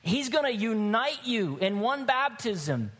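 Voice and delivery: high-pitched, emphatic sermon climax; impassioned preaching. Real, not acted. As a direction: proclaim it to the congregation with conviction.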